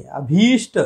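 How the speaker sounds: अभीष्ट with stretched भी; correct long ee. Shown as a demonstration. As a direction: hold the ee in अभीष्ट. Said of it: "ये सही है".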